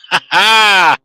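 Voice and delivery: loud, taunting "ha ha" laugh; mocking laugh. Real, not acted. Gloating at the one he roasts, taunting laugh. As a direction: rub it in with a laugh.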